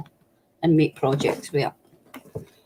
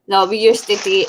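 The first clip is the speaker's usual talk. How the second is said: ordinary talk, nothing marked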